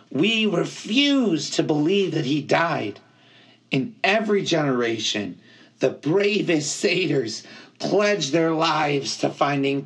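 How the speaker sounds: high, earnest satyr voice; impassioned high-pitched satyr storyteller; Grover, fervent. A narrator's reading. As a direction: recount it with devotion, pitched high. Grover tells the legend.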